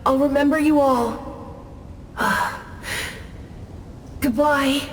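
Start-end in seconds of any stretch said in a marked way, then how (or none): 2.1-4.1 a soft, low fading sigh; long sigh. Acted. Departing spirit sighing before saying goodbye. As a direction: breathe out as you fade away.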